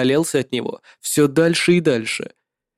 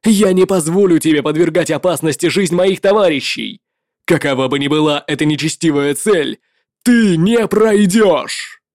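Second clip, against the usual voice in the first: heroic shout. Acted. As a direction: stand firm and bellow the vow. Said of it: "Его голос звучал достаточно громко".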